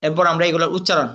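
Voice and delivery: usual speaking voice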